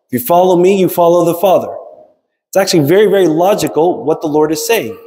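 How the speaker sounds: ordinary talk, nothing marked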